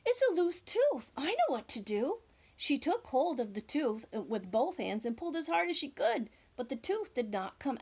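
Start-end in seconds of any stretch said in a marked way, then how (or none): 0.1-2.2 higher, lightly voiced mother; a knowing mother; mother's voice. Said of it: "she said".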